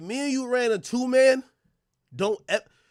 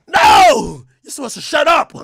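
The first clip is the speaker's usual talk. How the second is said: mock shout; 4 semitones higher, 8 dB louder than usual. Retold shout at the listener.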